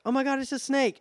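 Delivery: high, panicked shriek; mock scream. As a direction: shriek the warning in fright. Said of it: "starts screaming".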